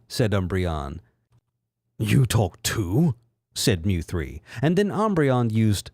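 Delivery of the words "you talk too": villain voice. Acted. Villain Mew three, slow.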